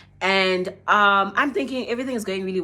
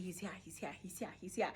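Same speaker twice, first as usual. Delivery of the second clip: much softer hushed he's here repeated; excited whisper; 19 dB quieter, faster than usual. Retold or imitated. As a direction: hiss it to your friend. Whispering excitedly to friend Kylie.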